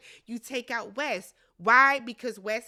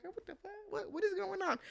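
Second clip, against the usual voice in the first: confused; 8 semitones higher, 9 dB quieter, faster than usual. Retold or imitated. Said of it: "Carolyn is still like".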